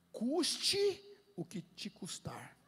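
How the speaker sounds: "custe" punched and drawn out, then slow; weighty emphasis. Retold or imitated. Holy Spirit's costly command, slow and emphatic on "custe". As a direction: stretch "custe" hard, then let the rest fall slowly.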